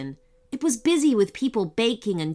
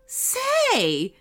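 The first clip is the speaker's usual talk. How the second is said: suspicious; 13 semitones higher than usual. Acted. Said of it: "looking at her suspiciously".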